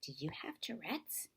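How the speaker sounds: soft unvoiced whisper. A narrator's reading. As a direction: lean in and whisper it. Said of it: "whispered to me".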